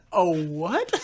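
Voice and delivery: loud, high, laughing incredulity; baffled disbelief. Retold or imitated. Storyteller reacting to coworker's anaconda scenario, baffled disbelief.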